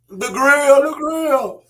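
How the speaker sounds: ordinary talk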